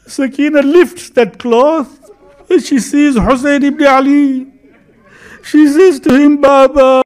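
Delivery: high, slow, tearful majlis lament; keen it high and slow, as if grieving; preached lament. Acted. Preacher mourning for the congregation as Sakina pleads to Baba, high and slow.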